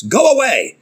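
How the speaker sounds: high-pitched demon's outcry; crying out. Acted. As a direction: cry out like a demon confronted. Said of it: "cried out at the top of his voice".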